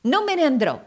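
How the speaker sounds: high, loud refusal; defiant exclamation. A narrator's reading. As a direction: cry it out in firm defiance. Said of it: "esclamò".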